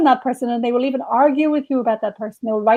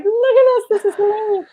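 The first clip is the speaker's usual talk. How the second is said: much higher, a gleeful disbelieving squeal; giddy mock amazement; 10 semitones higher than usual. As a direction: gush like kids who sneaked into the party. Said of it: "we'd just be like".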